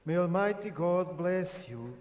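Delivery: ordinary delivery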